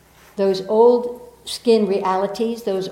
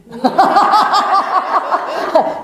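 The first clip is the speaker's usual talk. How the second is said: long, high, loud burst of laughter; big laugh; 10 semitones higher, 7 dB louder than usual. Real, not acted. Laughing at the man's rule.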